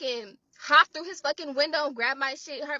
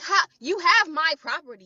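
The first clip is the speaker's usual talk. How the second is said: angry; 3 semitones higher, 8 dB louder than usual. Retold or imitated. Arguing with the thief.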